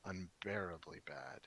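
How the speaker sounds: slow, low, sinking voice; sighing dismay. Acted. Mac Reedus sighing from the floor over how bad it was, worn-out dismay. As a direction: let it drag and fade softly, worn out by it. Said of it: "Mac Reedus sighs, he says".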